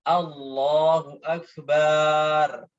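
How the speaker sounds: "Allahu Akbar" stretched in a different spot, slow; another wrong takbir. Shown as a demonstration. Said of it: "juga tidak boleh". ("Allahu Akbar" dengan panjang yang salah)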